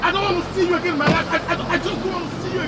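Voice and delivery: high, fast angry outburst; furious rejection. Acted. Banishing someone in a quarrel.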